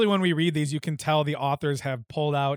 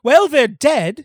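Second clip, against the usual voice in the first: louder, slow and blunt; loud blunt retort; 9 semitones higher, 9 dB louder, slower than usual. Acted. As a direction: snap back at the grandmother. Deadpan shock reply, loud.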